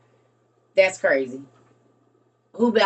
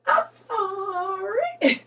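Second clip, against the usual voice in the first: high, slow laugh-cry apology; sorry through laughter; giggling apology; 11 semitones higher, much slower than usual. Real, not acted.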